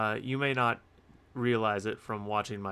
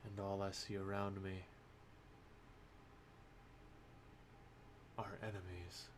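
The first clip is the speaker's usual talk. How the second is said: solemn poem recitation; 15 dB quieter than usual. Acted. Poet opening a book review with bleak verse, hushed and solemn. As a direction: recite it quietly with long, heavy pauses.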